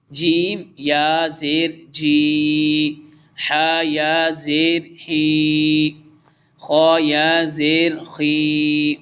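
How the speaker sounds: each letter drawn out, "जीम या ज़ेर जी" held long; slow drill recitation. Shown as a demonstration. Tutor reciting the letters for the student, slow and drawn out. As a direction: chant it like a tutor. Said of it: "last one पढ़ते हैं".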